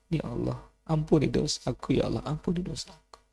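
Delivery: quiet, tearful prayer, voice breaking; a softly sobbed prayer for forgiveness; soft weeping plea. Acted. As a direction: whisper the plea as if sobbing. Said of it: "didapati Sa'labah menangis".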